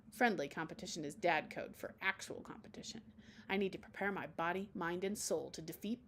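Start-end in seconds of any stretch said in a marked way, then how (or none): none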